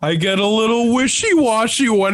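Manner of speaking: lightly voiced goofy snowman; a hint of cheerful snowman; Frosty voice. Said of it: "the voice of Frosty".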